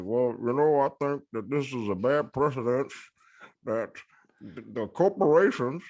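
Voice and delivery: light mock politician voice, slowing and rising at the end; lightly lampoon a senator's hedging, drawn out at the end; imitating Mitch McConnell. Podcaster mocks a senator on corporations, lightly voiced. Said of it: "Mitch McConnell who's like".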